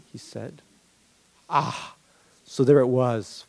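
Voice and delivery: ordinary delivery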